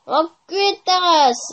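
ordinary talk

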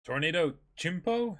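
Chimpo said with a chi sound, not te; Tornado Chimpo, start Chimpo with a chi. Shown as a demonstration. A fan asks the author to say Chimpo with a chi. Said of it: "please say Nami's technique ... with a chi instead of a te".

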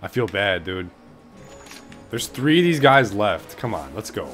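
normal talking voice

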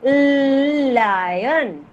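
Lion drawn out, tongue up on the L; demonstrated Lion. Shown as a demonstration. Teacher to children, drawn-out Lion. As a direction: model the L in Lion for the class. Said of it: "your action should be like this".

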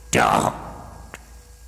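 hard, rusty voice struggling out each syllable; like an old key turning in a stiff lock; rusty Dalek voice. A narrator's reading. Damaged Dalek forcing out its first word. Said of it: "the creature managed to say".

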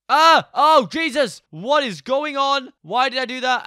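high, loud panicked shrieking; real fright, yelling; panicked scream. Real, not acted. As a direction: scream as the game goes haywire. Game glitch panic.

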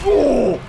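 sudden loud "oh"; startled "oh!". Real, not acted. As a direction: cry out as the blow lands. Reacting to hit in fight.

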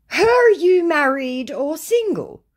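high, slow mocking scammer. Reading scammer texts aloud in a high mock voice. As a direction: ham up the creepy suitor, drawn out.

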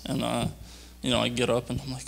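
ordinary talk

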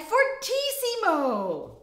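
loud, high, emphatic term; very loud fortissimo. Shown as a demonstration. Teacher listing dynamics up to loudest, very loud. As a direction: call it out at top volume. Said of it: "in order from quietest to loudest".

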